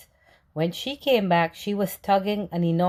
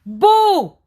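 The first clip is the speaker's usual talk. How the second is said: high, loud sudden shout; a little fox's loud, gleeful boo; playful yell; 13 semitones higher, 11 dB louder than usual. A narrator's reading. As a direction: jump out and shout it to scare. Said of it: "She crept up behind him and yelled".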